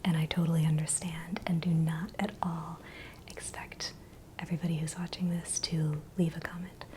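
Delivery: ordinary delivery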